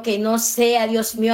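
ordinary delivery

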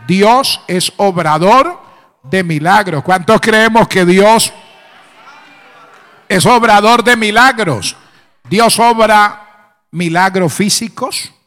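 loud, emphatic preacher rallying the congregation; ringing declaration of faith, pushed loud; full-force preaching. Real, not acted. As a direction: proclaim it loudly to stir up the believers.

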